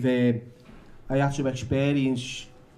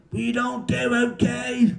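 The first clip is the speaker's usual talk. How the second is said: slow, high group chant; group in unison; 10 semitones higher, slower than usual. A narrator's reading. Therapy group answers Billy all together, slow high unison. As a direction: draw it out like a whole group chorusing. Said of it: "Everybody said in union".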